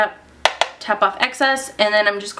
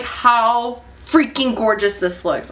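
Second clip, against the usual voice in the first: ordinary talk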